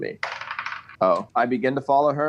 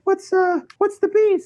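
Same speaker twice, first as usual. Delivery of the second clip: high character voice; 5 dB louder, slower than usual. Acted. Player voices his character.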